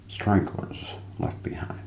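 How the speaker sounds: ordinary talk, nothing marked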